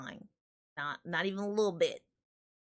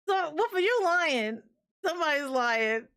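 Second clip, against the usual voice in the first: playful accusation; 6 semitones higher, 4 dB louder than usual. Real, not acted.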